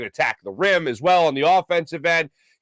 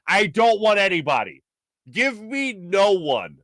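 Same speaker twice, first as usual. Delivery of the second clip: emphatic refusal; 4 semitones higher, slower than usual. Acted. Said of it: "ever said".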